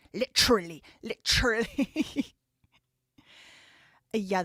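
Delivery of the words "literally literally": LITERALLY as an exaggerated "li-CHRULLY", loud on the second half; mock LITERALLY with a big crunched TR; mocking British-style pronunciation. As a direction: stretch the end of LITERALLY, "lit-trulleee". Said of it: "American kids are saying "literally".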